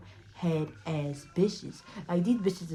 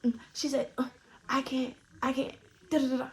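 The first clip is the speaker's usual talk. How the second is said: higher-pitched imitation of her whining on; mock fretful chatter; mock complaining; 6 semitones higher, 3 dB quieter, faster than usual. Acting out her friend's complaining.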